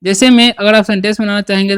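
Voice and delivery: ordinary delivery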